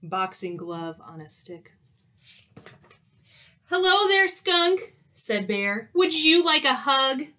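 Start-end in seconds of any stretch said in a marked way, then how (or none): 3.7-7.3 high, loud friendly bear greeting; chirpy storybook bear voice; cheerful bear. Acted. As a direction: greet them big and sunny.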